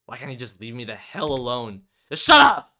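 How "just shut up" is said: much higher and much louder, a screamed shut up; girl losing her temper, yelling; furious yell. Acted. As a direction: scream it at someone across the room. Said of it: "Sudden yell jerks me".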